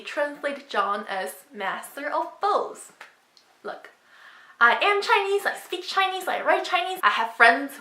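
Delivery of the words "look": ordinary delivery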